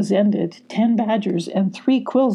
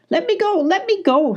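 much higher, frantic plea; desperate plea; 12 semitones higher than usual. A narrator's reading. Sylvie begging the foxes to release her, much higher. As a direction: struggle to break free. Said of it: "Sylvie cried".

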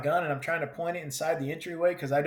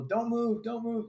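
mild mock yell, pitched much higher; retold yell; 8 semitones higher than usual. Teammates calling to wounded soldier, mild mock yell, higher. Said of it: "I can hear people yelling".

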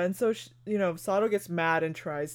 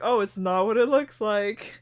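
louder, higher acted denial; mock protest; 4 semitones higher, 7 dB louder than usual. Friend retelling his excuse to her co-host, mock protest. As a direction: protest as if caught out. Retold flustered denial of a scene.

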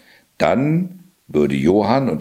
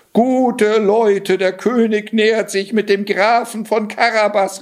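calling out; 13 semitones higher than usual. Acted. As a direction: hail the village from the road. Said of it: "rief er ihnen zu".

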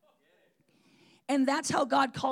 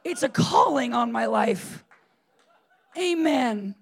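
mock solemn laughing; 5 dB louder than usual. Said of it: "I'm like".

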